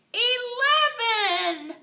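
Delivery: high, loud, very slow sung-out cry; ecstatic cry. A narrator's reading. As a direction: stretch the number out in pure joy. Climax of the counting.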